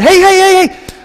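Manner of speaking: high, loud calls of hey; excited shouting. Acted. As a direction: yell to get the men's attention. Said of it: "They're shouting".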